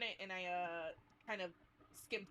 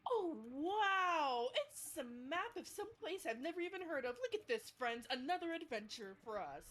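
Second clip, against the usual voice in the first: higher, louder mock amazement; hammed-up wonder; 7 semitones higher, 3 dB louder than usual. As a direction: put on a big show of wonder for the friends. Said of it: "make a show of looking back down and going".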